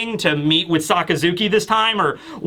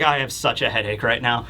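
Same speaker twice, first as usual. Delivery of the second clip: weary deadpan; 7 semitones lower, 3 dB quieter than usual. Retold or imitated. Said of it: "Augur is like".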